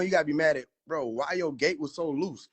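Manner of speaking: ordinary delivery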